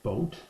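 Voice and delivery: boat said clearly with a long oh; careful example word. Shown as a demonstration. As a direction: model the word boat for the ear. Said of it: "if I'm saying the word".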